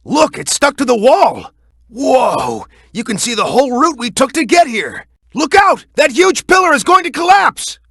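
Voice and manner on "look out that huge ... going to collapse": high, loud alarmed yell; urgent warning shout. Acted. Ally warning Shadow about the collapsing pillar, alarmed high shout. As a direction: bark the warning across the ruins.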